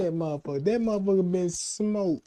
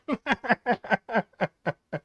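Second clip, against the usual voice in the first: high, rapid-fire chuckle; a string of short falling ha-has; staccato laugh; 5 semitones higher than usual. Real, not acted. Comic cracking up at his own joke, staccato laugh.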